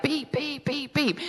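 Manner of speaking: high, soft run of beeps; imitated alarm beep. Storyteller mimicking the clock by the bed, imitated alarm beep. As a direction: mimic a bedside alarm going off.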